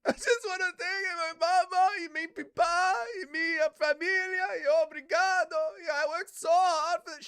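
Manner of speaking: high, loud mock thank-you speech; hammed-up Brazilian victory speech; mock winner speech. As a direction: parody a crying fighter's thank-yous. Mocking fighter's tearful speech.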